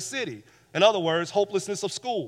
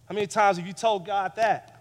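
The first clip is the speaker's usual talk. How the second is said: usual speaking voice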